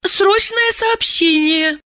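high, slow timid squeak. Acted. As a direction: squeak it out shyly. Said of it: "робко пропищала она".

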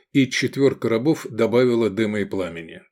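usual speaking voice

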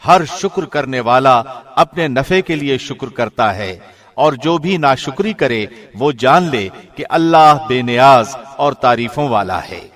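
ordinary delivery

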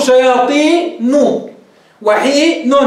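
"شياطين" split slowly as shayaatii-nu; "حين" broken into hii-nun; damma after nun. Shown as a demonstration. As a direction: stretch "شياطين" and "حين", then land on the voweled nun. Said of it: "بضمة واقعة بعد النون".

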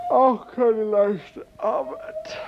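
breathy whisper, slow and weary; whispered aside. Acted. Dream seller muttering to himself in a slow whisper. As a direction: sigh it out under the breath.

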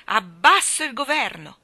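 thunderous cry of the people; defiant shout. Acted. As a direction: hurl it into the air. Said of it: "scagliò nell'aria questo grido inatteso".

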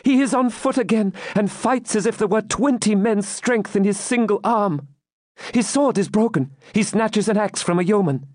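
high-pitched, thrilled eyewitness report; eager maiden. Acted. Maiden reports champion fighting back, eager. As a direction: follow every blow excitedly, pitched high.

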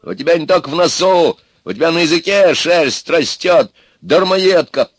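high, loud volley of insults; angry yelling. A narrator's reading. Ivan hurls insults at Baba Yaga, yelling. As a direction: yell each jibe back at her. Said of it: "тоже заорал Иван".

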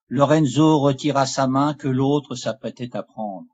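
usual speaking voice

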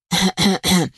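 creaky throat-clearing; old grouse's grunting. A narrator's reading. Old grouse shifts in his nest, grunting. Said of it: "прокряхтел он".

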